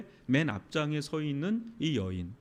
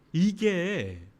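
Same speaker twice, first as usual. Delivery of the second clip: ordinary delivery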